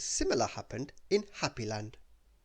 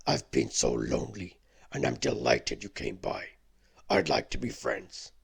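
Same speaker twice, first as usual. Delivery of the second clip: much lower, louder beast voice; a lonely beast asking for a friend; 7 semitones lower, 5 dB louder than usual. Acted. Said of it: "said the beast".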